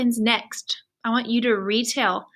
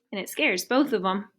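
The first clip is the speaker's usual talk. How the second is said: ordinary delivery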